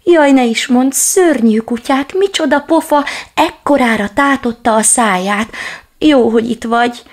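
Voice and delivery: high, loud scared babble; frightened chatter. Acted. Little dog tells the big dog of the scary dogs, high and loud. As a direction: gush out the fright.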